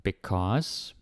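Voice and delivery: ordinary talk, nothing marked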